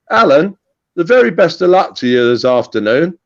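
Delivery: ordinary delivery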